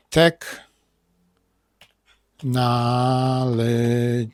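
Technology drawn out with stress on nal; Technology stretched, tek-NAL-e-gy; slow stressed demo. Shown as a demonstration. Teacher modelling stress on ology for students. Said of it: "Bo akcentujemy ology".

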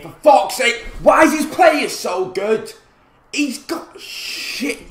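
loud, high-pitched complaint; angry shout. Real, not acted. Gamer complaining about opponent's player, angry shout. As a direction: burst out in real frustration. Conceded again, raging.

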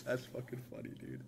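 normal talking voice

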